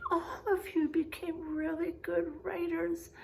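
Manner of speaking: whispered warm praise; soft praise. Real, not acted. Teacher quietly reassuring the whole class they write well.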